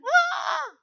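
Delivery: short high shriek mimicking fear; a sharp frightened yelp; mock scream. Preacher mimicking the panicked disciples, a high shriek.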